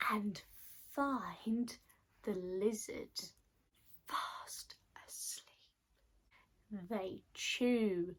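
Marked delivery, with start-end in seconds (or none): 0.1-6.9 softening into a whisper; hushed, secretive storytelling, fading to a breath; hushed creeping. A narrator's reading. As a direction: tiptoe round someone asleep. Creeping up on sleeping lizard.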